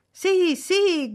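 high, loud cheer of assent; eager cry. A narrator's reading. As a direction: cry out eagerly like a young crowd. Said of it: "gridò tutta la gioventù all'unisono".

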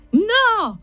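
high, savage shouted no; a mob howling him down; fierce high-pitched shout; ferocious chorus yell. A narrator's reading. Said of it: "urlò ferocemente la congrega".

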